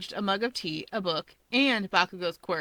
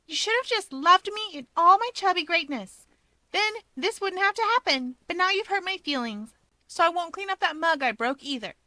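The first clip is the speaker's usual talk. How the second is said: put-on higher, lively girl's voice for Rika; Rika's bright voice; 10 semitones higher than usual. Rika praising Mirko's reflexes, raised eager voice. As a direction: play Rika gushing over a hero.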